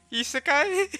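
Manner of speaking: high incredulous laugh-question; laughing disbelief. Real, not acted. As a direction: ask it through laughter.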